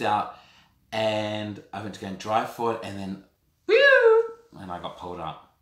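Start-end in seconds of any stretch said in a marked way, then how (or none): 3.7-4.3 a sharp high whistling whoosh; a sudden high whew as the police appear; mock whoosh. While pulled over by police, a loud high whoosh.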